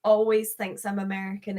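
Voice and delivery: ordinary talk, nothing marked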